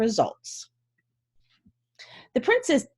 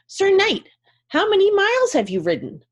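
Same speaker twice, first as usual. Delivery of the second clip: high, bright-voiced young princess, louder; a high little princess voice, louder; 11 semitones higher, 4 dB louder than usual. Princess questions the knight.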